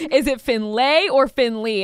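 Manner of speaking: Finlay ending in LAY, Finley ending in LEE; contrasting two pronunciations. Retold or imitated. Asking boyfriend his last name, contrasting Finlay and Finley endings. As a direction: set "Finlay" against "Finley" on the last syllable. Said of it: "How do you say your last name?".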